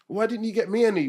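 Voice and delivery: high-pitched imitated whinge; put-out kid demanding her share; sister's complaint. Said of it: "then she'd say to us".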